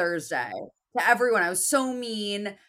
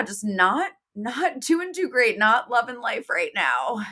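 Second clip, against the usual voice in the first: ordinary delivery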